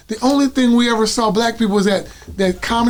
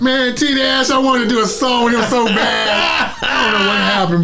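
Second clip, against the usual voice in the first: excited; 5 semitones higher than usual. Real, not acted. Excited about a singer.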